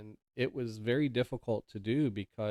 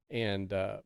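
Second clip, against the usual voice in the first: ordinary talk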